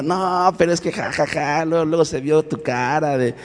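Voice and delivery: high mocking laugh before the jab; mocking cousin laugh. Young cousin mocking her cousin's fragile masculinity, laughing high. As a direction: tease him with a high cackle. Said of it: "y mi prima".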